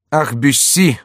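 a mild flare of irritation; a touch of princely temper; hot-tempered retort. A narrator's reading. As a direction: snap back at the nosy courtier. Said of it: "запальчиво отвечал ему герцог".

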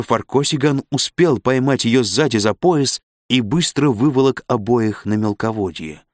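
usual speaking voice

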